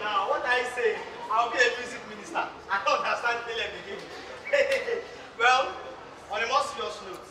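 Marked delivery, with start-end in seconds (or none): 4.1-5.3 airy, high burst of laughter; high breathless laugh. Real, not acted. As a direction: laugh off the silly remark. Laughing at Caleb's joke.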